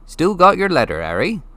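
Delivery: put-on a giant speaking casually to a boy; Hagrid, big friendly giant. A narrator's reading. Hagrid explaining to Harry, casual giant voice.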